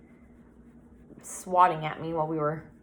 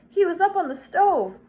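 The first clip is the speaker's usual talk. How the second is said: high, loud, pleading explanation; upset protest; 12 semitones higher, 6 dB louder than usual. A narrator's reading. Boy explaining the cat's death, upset protest. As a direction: protest urgently in a raised voice.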